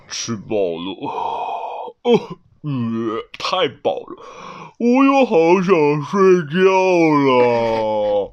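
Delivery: slow, drawn-out yawny complaint; drowsy red panda after a feast; stuffed and sleepy. Acted. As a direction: groan with an overfull belly.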